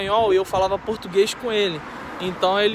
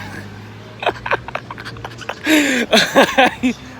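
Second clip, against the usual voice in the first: breathy laughing fit; 6 semitones higher, 5 dB louder than usual. Real, not acted. Laughing at his own story.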